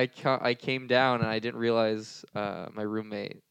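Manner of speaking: usual speaking voice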